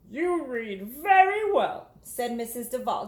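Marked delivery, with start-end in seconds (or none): 0.1-1.9 slow, gentle teacher praising a pupil; warm teacher voice. Acted. Teacher praising Jamaica's reading, warm and slow. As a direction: praise her reading kindly.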